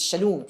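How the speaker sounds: Shalom said with OO, sha-LOOM; corrected name. Shown as a demonstration. Teacher gives the man's real name, pronounced sha-LOOM. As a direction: make the end of Shalom rhyme with "room". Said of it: "His, his name is Shalom".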